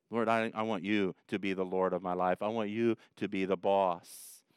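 softly praying to the Lord; quiet prayer. Shown as a demonstration. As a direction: pray it humbly and low.

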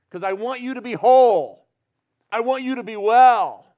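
loud, high, surging preacher's voice; impassioned preaching. Real, not acted. Pastor preaching fervently to the church, loud and high. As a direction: preach it out with full fervor.

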